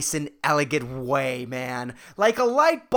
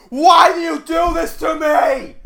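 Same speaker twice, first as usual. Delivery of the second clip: high, loud, anguished cry of despair; mock anguished shout; 11 semitones higher, 10 dB louder than usual. A guy on the bus, crying out in mock anguish. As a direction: yell it like a man losing it on the bus.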